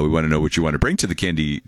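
usual speaking voice

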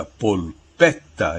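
polpetta said slowly with a clear Italian L; Italian pronunciation. Shown as a demonstration. Professor shows listeners the Italian word, slow Italian pronunciation. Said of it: "é assim que se diz em italiano".

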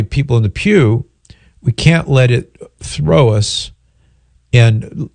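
ordinary talk

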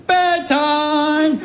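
high, singsong parent's announcement; playfully surprise a toddler with bedtime; bedtime call. Retold or imitated. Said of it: "I snuck up behind her and I said".

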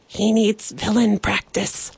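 usual speaking voice

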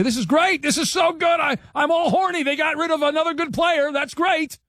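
high, gushing fake excitement; mocking excited fan. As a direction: gush like a thrilled fan. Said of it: "guys like you will call up and say".

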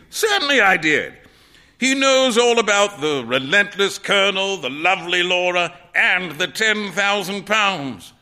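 lightly voiced, emphatic artist; teasing painter. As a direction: list it all with mild relish. Trevor's voice in the story.

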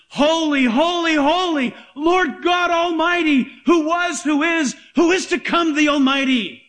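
high, measured proclamation of a holy chant; proclaimed angelic praise. Acted. Preacher voicing the seraphim's message to the congregation, reverently proclaimed.

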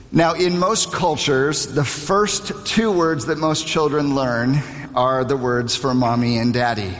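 normal talking voice

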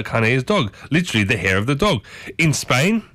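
usual speaking voice

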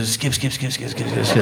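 very soft repeated skip, skip; breathy mimic of rotor blades; imitated helicopter sound. Seagal imitating the helicopter, very soft repeated skips. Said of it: "He goes".

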